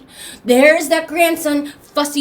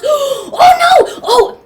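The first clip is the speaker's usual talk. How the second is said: high, loud shocked exclamation; alarmed cry; 13 semitones higher, 9 dB louder, slower than usual. Acted. Baby Raven peeks in the forbidden box, loud startled cry. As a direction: gasp it out in sudden alarm.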